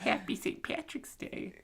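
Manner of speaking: hushed whisper. Real, not acted. Host adding a holiday wish after goodbye, whispered.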